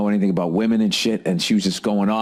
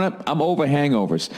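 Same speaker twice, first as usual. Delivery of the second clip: ordinary talk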